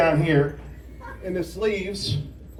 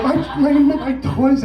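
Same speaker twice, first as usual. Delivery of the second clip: put-on little kid whine; 8 semitones higher, 3 dB louder, slower than usual. Little brother whining about toy.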